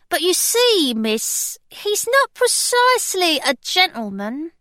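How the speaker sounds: a nervous maid announcing a caller; parlour-maid. Acted. Bess worried about the caller.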